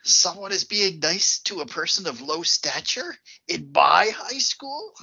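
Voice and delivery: hammed-up indignant bully, rising high; mock outrage.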